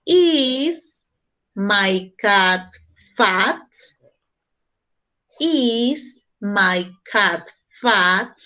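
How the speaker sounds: very slow, each word laid out for the class; teacher modelling the question slowly for Spanish-speaking students; slow model question. Shown as a demonstration. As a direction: read it slowly so students can copy. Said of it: "¿Cómo lo hago en pregunta?".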